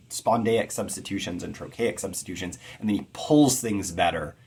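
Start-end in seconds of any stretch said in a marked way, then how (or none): none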